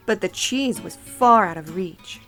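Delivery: ordinary delivery, nothing marked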